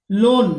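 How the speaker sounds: लोन loud, long o; say loan. Shown as a demonstration. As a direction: call out लोन clearly. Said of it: "यहाँ देख लीजिए". (लोन का उच्चारण ज़ोर से)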